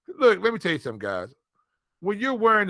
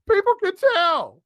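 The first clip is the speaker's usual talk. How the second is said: louder, higher insistence; emphatic; 11 semitones higher, 4 dB louder, slower than usual. Real, not acted. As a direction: drive the point home hard. Repeating the scolding with emphasis.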